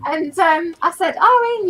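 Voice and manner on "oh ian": teasing. Retold or imitated. Retelling own teasing reply.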